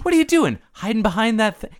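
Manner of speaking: very high, playful teasing; high-pitched, breezy wheedling; mock casual coaxing. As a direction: imitate a boyfriend cajoling in a lifted voice. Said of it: "he's super casual".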